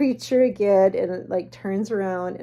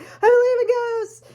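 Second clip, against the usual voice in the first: frightened; 7 dB louder than usual. Retold or imitated. Frightened friend answers taunt.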